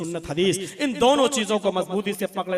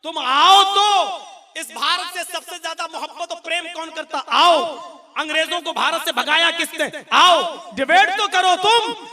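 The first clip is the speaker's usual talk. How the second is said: challenging shout; 6 semitones higher, 5 dB louder than usual. Real, not acted. Challenging opponents to debate.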